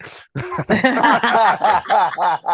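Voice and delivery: real, rolling laughter; laughing. Real, not acted. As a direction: crack up for a couple of seconds. Laughs at own admission.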